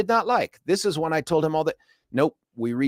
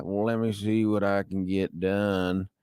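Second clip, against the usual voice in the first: slow, lower Southern drawl; 4 semitones lower, 4 dB quieter, slower than usual. Retold or imitated. Fraud agent drawling slowly to a caller in a Southern accent. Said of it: "slow talking Southern guy".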